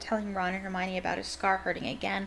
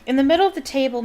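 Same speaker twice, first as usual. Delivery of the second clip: usual speaking voice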